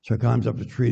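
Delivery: ordinary delivery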